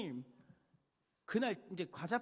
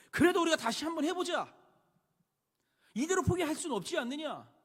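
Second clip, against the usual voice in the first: urging call; 8 semitones higher, 8 dB louder than usual. Retold or imitated. Faithful friend urging to rebuild.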